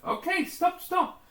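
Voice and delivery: trapped man squeals at the approaching drill; panicked yelp. Acted. Mole drill about to hit him.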